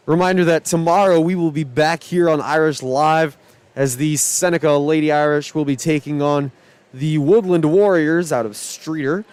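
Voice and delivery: normal talking voice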